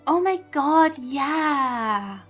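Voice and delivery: ordinary delivery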